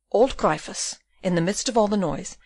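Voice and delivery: plain reading voice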